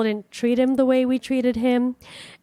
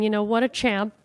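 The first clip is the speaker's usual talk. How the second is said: ordinary delivery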